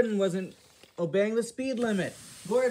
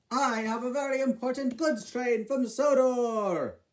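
higher, boastful engine voice; proud engine voice; 6 semitones higher, 3 dB louder than usual. Acted. Gordon boasting to his brother about his goods train, proud higher voice. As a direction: puff up and boast like a big engine.

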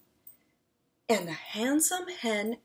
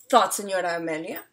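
ordinary delivery, nothing marked

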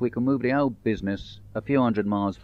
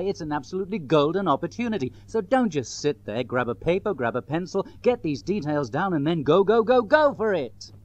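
high-energy salesman building to an excited, louder climax; pushy advert voice, ending in a rousing cheer; hard-sell pitch; 5 semitones higher, 5 dB louder than usual. Shown as a demonstration. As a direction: sell it hard, punching the key words. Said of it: "much more comfortable with what I would call the".

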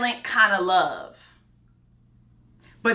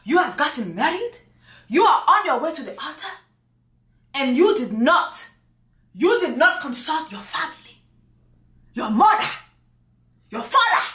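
put-on higher-pitched African accent, indignant mother; African mother impression; 5 semitones higher than usual. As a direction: scold your son in a hammed-up African accent. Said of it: "my African impression".

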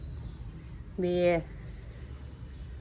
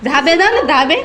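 calling out; 7 semitones higher, 15 dB louder than usual. Real, not acted. Shooing kids to terrace.

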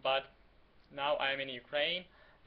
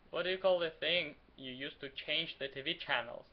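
ordinary talk, nothing marked

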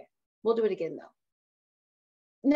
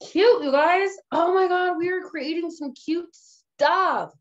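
excited gushing; 8 semitones higher, 6 dB louder than usual. Real, not acted. Gushing over her own design.

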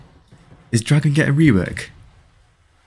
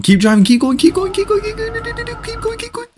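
climbing into a high squeak; squeaky high urging. Real, not acted. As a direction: cheer them on in a squeaky voice. Urging on during the game.

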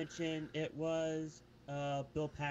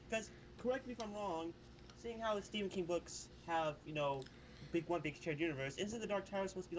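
ordinary delivery

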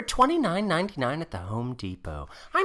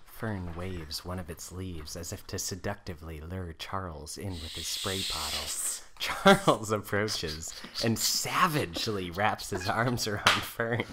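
low, even storyteller voice; story narration; 10 semitones lower, 5 dB quieter than usual. A narrator's reading. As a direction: set the scene like a narrator. Narrator describes the scene.

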